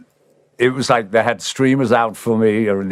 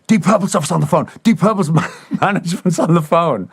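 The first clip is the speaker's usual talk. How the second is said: much higher voice of a secretary bursting in with news; excited secretary; 9 semitones higher than usual. Retold or imitated. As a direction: announce the call breathlessly, pitched up high. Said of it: "secretary came banging on my door and said".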